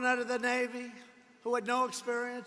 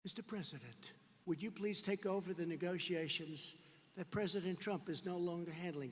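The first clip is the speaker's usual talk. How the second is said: much softer, lower mock request; hushed imitation of a timid ask; mock quiet plea; 5 semitones lower, 10 dB quieter than usual. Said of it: "how about saying".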